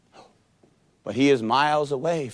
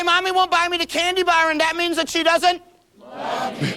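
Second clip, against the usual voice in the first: put-on high, whining child voice; whiny kid's horse. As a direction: wail it like a hurt toddler. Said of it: "we're gonna be the little kid's horse now".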